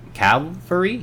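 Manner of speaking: rising, unsure Calvary?; unsure pronunciation. Real, not acted. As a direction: ask Calvary? as a question. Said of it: "Yes, Calvary".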